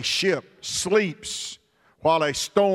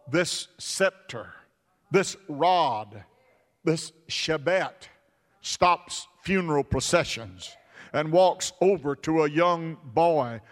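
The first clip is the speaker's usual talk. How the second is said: ordinary delivery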